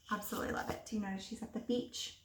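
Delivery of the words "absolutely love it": breathy hushed admiration; whispered delight at the pictures; soft whispered aside. Real, not acted. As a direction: murmur it under your breath. Teacher's soft aside on illustrations.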